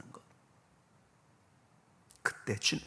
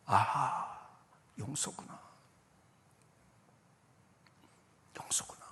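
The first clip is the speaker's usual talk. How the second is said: hushed realisation; 3 semitones lower, slower than usual. Retold or imitated. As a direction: murmur it to yourself as it dawns on you. Relives quiet realisation about forgiveness.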